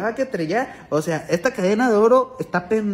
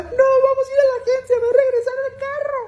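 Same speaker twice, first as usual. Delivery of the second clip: much higher, louder falsetto; hammed-up weepy demand; mock tearful lady; 6 dB louder than usual. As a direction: insist through mock tears like the señora. Lady tells driver where to go.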